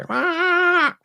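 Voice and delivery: high, loud bird squawk; imitated raven caw. Raven breaks up the sweet birdsong, loud caw.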